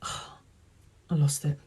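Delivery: soft, slow sigh, losing the thread. Real, not acted. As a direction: sigh it out, deflated. Loses her train of thought.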